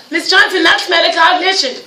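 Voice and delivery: put-on high excited child voice after a gasp; excited kid. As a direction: play a kid proud of a big word. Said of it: "when one of your kids says".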